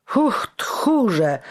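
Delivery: high scornful outcry; exclaimed. A narrator's reading. Giano scoffs at his cowardly friends. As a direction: throw the word at them. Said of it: "wykrzyknął Giano".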